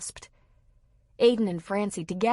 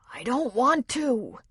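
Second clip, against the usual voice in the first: wheezed; 6 semitones higher, 3 dB louder, slower than usual. A narrator's reading. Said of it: "Art wheezed".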